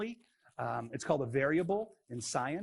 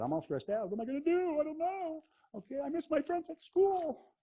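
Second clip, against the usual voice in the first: much higher, mock-anxious little whine; silly stressed-out voice; 13 semitones higher than usual. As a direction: play a fretting kid who misses school, hammed up. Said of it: "Where's that voice come from?".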